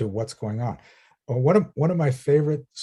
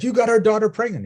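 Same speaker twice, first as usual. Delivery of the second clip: higher, louder mock accusation; parents yelling; 9 semitones higher, 6 dB louder than usual. Angry parents accusing the monk next door, mock yell. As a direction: hurl the blame at him. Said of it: "they yelled at the monk".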